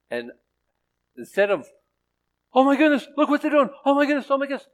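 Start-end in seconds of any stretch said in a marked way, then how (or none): 2.5-4.7 high, loud frantic flapping; hammed-up alarm, pitched way up; mock panic. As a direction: imitate someone freaking out. Mocks panicking over the news.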